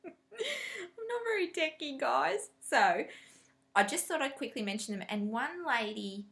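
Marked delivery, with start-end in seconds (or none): 0.0-2.5 high, slow laughing admission; admitting to viewers she's not techie. Real, not acted. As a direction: giggle the confession. Laughing confession.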